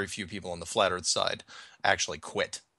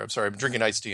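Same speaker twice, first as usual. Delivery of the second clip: ordinary talk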